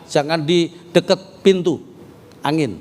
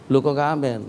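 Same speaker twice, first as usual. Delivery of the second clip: ordinary talk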